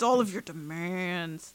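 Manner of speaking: slow sinister chant; dark fairyland threat, slowed; dark, slow menacing repeat. Shown as a demonstration. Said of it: "in a really dark way".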